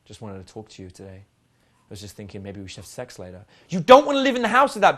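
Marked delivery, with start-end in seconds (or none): 0.0-3.5 low, soft, lifeless monotone; dreary, deadened low mumble of a proposition; mock unsmiling person. Shown as a demonstration. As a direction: drone it quietly with no smile. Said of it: "who's constantly like this".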